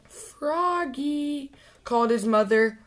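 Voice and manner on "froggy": calling out. Acted. Mother calls Froggy.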